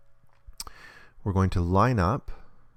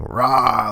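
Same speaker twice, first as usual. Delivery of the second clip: higher, louder drawn-out "raah"; zombie roar; 4 semitones higher, 5 dB louder than usual. Acted. Zombies closing in on Rick, imitated roar. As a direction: imitate a roaring zombie horde. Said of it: "the zombies are going".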